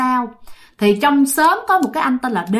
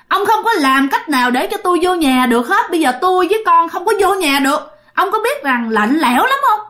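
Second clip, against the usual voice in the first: the drowned wife complaining through him, pitched up; ghost wife's voice; 6 semitones higher than usual. Retold or imitated. Drowned wife's spirit reproaches her husband, higher pleading ghost voice.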